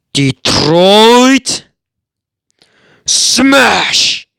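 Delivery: huge drawn-out battle cry, rising high; heroic attack shout. Acted. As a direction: bellow the finishing move with full force. Shouts All Might's attack move.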